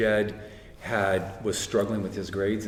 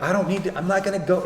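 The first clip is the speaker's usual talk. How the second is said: higher-pitched, insistent retelling; retold firm refusal; 7 semitones higher, faster than usual. Son explaining he won't go to college, retold insistence. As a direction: act out a mild, higher-voiced protest.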